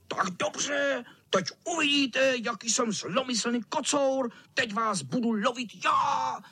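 high, menacing cat voice; hammed-up wicked tomcat; wheezing tomcat threat. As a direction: threaten them in a high villain squeal. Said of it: "sýpal kocour".

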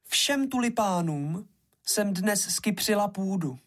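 high character voice for a garden hoe; worn-out hoe. A narrator's reading. Hoe recounts her work to the rakes, high worn-out voice. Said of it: "řekla Motyčka a protahovala si hřbet".

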